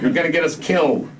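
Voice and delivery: loud, sharp order; lieutenant scolding his squad, loud and angry; pissed off. Acted. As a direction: bark it at the squad. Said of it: "Lieutenant North was pissed".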